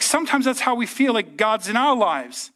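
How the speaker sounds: ordinary delivery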